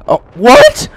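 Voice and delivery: high, loud, rising question; shocked outcry. Real, not acted. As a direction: shout it in disbelief. Shocked by the game.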